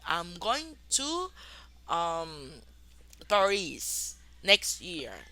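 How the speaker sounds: slow classroom demonstration; very slow model sentence. Shown as a demonstration. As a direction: stretch it out for the students. Teacher models future example.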